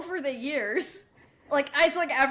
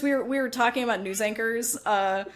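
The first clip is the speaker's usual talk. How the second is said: ordinary delivery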